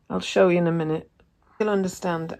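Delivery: ordinary delivery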